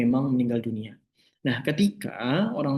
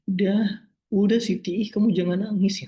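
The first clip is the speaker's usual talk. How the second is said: mock consoling voice; 7 semitones higher than usual. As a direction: parody someone brushing off her tears. Mocking people who shush grief.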